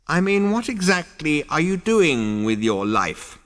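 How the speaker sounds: high, reproachful elder; scolding old ghost. Acted. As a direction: demand like a stern ancestor.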